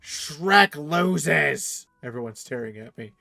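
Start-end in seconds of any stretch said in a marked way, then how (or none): 0.0-1.8 loud brash drinking challenge; rowdy tavern boast, shouted; boastful shout. Pretend. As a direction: bellow it like a bar challenge. Drunken boast in game.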